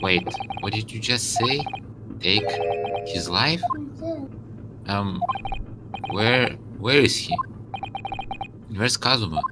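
softly voiced, hesitant game character; stunned suspect. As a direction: ask quietly, as if taking in bad news.

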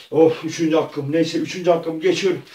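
usual speaking voice

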